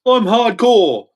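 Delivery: high, loud, drawn-out parody; puffed-up imitation of a hardcore poser; mocking boast. Imitating a fat rapper bragging, loud high-pitched mockery.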